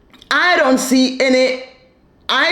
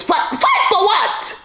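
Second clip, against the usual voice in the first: exasperated; 8 semitones higher than usual. Real, not acted. Host mocking the mom's advice, incredulous and high. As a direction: throw the words back in disbelief.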